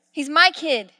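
ordinary talk, nothing marked

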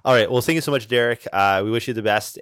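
ordinary talk

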